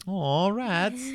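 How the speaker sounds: high cooing aw. Retold or imitated. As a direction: coo over cute pets. Quoting crowd reaction to rats.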